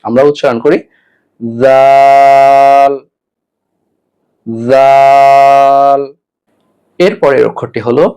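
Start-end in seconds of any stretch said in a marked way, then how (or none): 1.6-6.0 "জাল" with a voiced th, drawn out very long; tongue to tooth tips, then hold "জাল" on one note; loud, level, sustained "জাল"; Arabic letter demonstration. Shown as a demonstration. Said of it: "দাঁতের আগার সঙ্গে লাগাতে হবে".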